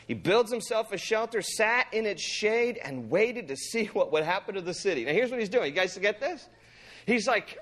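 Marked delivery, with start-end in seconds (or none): none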